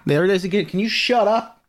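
high, loud "shut up" at the device; snapping at Alexa. Real, not acted. Yelling at his noisy Alexa, exasperated. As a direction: shout down the beeping speaker.

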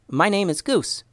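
put-on high, bright kid giving his name; young boy voice. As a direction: pitch it up like a small boy.

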